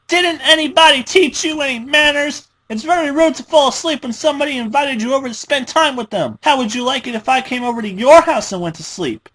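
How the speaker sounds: high, loud, fast scolding rant; lashing out. Acted. Frustrated Pinkie scolds her victim, high, loud and fast. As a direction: scold her like a bratty child. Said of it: "lashed out".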